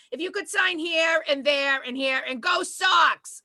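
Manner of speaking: put-on high, brash Boston accent; Boston-accented realtor with a shouted finish; Boston estate agent. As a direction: burst into broad Boston, building to a cheer. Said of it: "then she opened her mouth".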